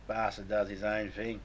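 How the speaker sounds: usual speaking voice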